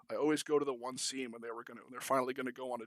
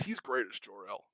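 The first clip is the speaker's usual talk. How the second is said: ordinary talk, nothing marked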